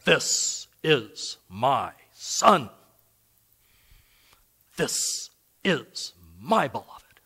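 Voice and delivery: slow, hushed voice from heaven. Retold or imitated. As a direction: speak it slowly, hushed, as God. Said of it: "a voice came from heaven".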